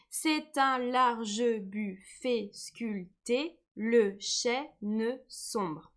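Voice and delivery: very slow, each syllable spaced out; syllable-counting read. Shown as a demonstration. Teacher counting syllables aloud for pupils, very slow. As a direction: tap out every syllable of the line. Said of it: "rereads line counting syllables".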